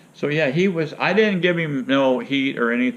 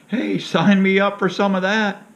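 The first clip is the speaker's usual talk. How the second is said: sarcastic; 10 semitones higher, 5 dB louder than usual. Real, not acted. Sarcasm about drug side effects.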